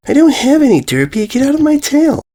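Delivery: high, exasperated little pony; irritated filly. Acted. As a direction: shoo her off sharply. Filly snaps at Derpy.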